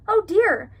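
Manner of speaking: high, loud gasp of dismay; reacting to the sad news; shocked. Reading shocked character line.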